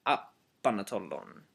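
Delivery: apanatolon run together with no O after the P; apanatolon, blending the words, no O; O dropped. Shown as a demonstration. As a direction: apanatolon said as one word. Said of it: "that O is dropped off completely".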